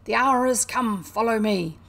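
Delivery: loud commanding battle call; rallying cry. A narrator's reading. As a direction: cry out to lead the charge. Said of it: "glanced round at his comrades and cried".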